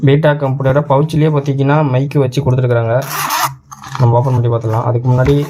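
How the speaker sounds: ordinary talk, nothing marked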